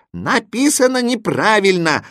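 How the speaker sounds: high-pitched collective verdict; chorus reply. A narrator's reading. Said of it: "хором ответили знаки".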